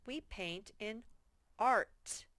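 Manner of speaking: ordinary delivery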